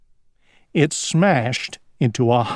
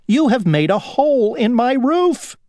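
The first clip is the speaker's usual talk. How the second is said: very high, aggrieved complaint; indignant worm; 11 semitones higher than usual. A narrator's reading. Worm to Grasshopper about its bitten roof, high indignant complaint. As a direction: protest in a high little worm voice.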